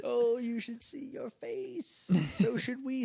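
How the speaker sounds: high, soft, drawn-out teasing; slow sing-song gotcha; mock gloating. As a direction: stretch it out, crowing over the victim. Mock gloating over fooled listeners.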